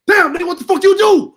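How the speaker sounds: high, loud laughing shout; imitating X's yell. X yells in disbelief that he neither smokes nor drinks. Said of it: "this is how he talk".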